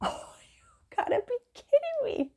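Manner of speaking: whispered you gotta be kidding me, exasperated. Retold or imitated. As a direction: breathe it out in disbelief. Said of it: "I was like".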